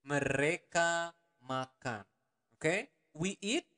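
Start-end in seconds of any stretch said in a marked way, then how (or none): 0.1-2.1 slow demo. Shown as a demonstration. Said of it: "teacher repeats slowly".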